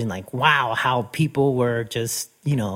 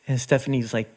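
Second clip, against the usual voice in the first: usual speaking voice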